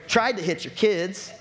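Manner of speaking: normal talking voice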